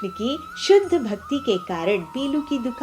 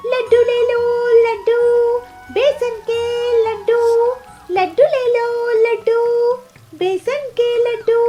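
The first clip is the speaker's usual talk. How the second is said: street vendor's call; 7 dB louder than usual. Acted. Peelu hawking her laddus to passers-by, high loud vendor's call. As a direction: sing out your wares at high pitch.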